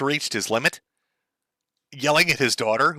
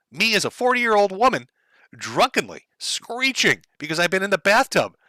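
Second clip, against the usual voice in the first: ordinary delivery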